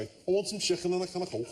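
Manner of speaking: a quick, light Scouse accent. Shown as a demonstration. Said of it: "can only do Scouse if I say".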